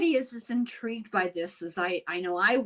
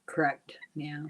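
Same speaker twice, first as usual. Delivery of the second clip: breathy whispered correct; whispered agreement; 5 semitones lower, 5 dB quieter than usual. Real, not acted. Co-host whispering agreement to her guest.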